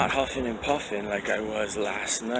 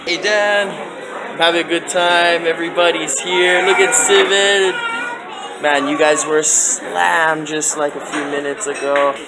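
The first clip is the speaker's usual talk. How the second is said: loud, bright greeting over noise; calling out; 8 semitones higher, 9 dB louder than usual. Real, not acted. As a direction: call out cheerfully across the room. Greeting friends in busy cafe.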